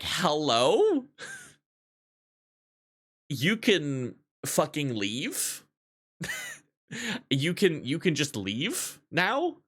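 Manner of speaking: slow, mocking you-can-leave; incredulous dismissal. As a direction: brush him off with disbelief. Telling rude customer to leave.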